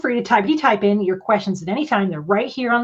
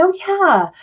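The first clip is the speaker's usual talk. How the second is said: mock eager yes; 10 semitones higher than usual. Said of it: "I said".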